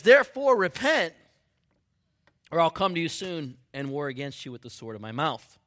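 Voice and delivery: loud, high proclamation of scripture; booming preacher reading out a warning; forceful preaching. Acted. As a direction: thunder the verse out to the congregation. Reads verse at full force.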